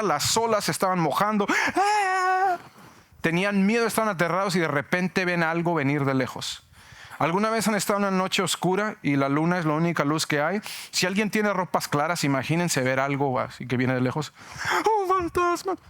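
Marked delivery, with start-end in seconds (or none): 1.7-2.6 high startled scream in the storm; frightened scream. Acted. Acting fear in the storm. 15.0-15.8 high, alarmed shout; frightened cry. Acted. Acting the disciples' fright.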